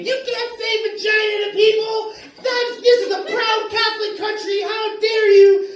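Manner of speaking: very high-pitched scolding woman; mock outraged yelling. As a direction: imitate an indignant yell, stretching the final words. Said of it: "started yelling at me".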